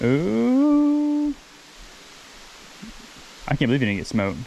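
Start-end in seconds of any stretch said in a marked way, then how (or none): 0.0-1.3 long, very high ooh of delight; a soaring, amazed ooh. Real, not acted. Sees the fish up close.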